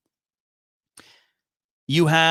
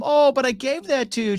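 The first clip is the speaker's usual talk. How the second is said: high, heartbroken rom-com plea; mock lovelorn whine, pitched way up; Sam; 11 semitones higher than usual. Sam hurt that Gilly returns his gift, heartbroken. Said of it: "Sam is heartbroken. He starts to go into this rom-com".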